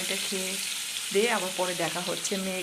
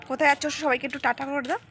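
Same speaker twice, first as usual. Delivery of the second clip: higher, louder coaxing to the little one; talking to toddler; 7 semitones higher, 9 dB louder than usual. Acted. Mother asking her daughter to wave bye to viewers, higher coaxing. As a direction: gently prompt the child to wave bye.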